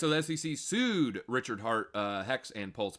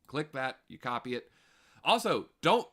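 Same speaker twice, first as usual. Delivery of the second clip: usual speaking voice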